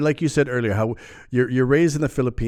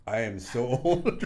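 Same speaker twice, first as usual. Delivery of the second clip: laughing at himself. Real, not acted. Host joking about own age.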